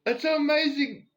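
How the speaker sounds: high, loud, delighted disbelief; excited greeting. Retold or imitated. Greeting Dave at five in the morning, thrilled and incredulous. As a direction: greet him like a long-lost friend. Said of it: "I'm like".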